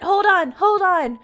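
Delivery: loud, high urgent hold on; excited hold on. Real, not acted. Streamer halting chat to check donations, loud and excited. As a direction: stop everything, you need to see this.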